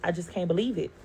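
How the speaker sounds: ordinary talk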